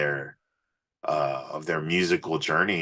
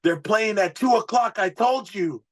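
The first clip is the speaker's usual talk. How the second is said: much higher, slightly louder insistent voice; imitating label rep; 4 dB louder than usual. Label rep telling the stage manager, high insistent voice. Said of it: "he's like".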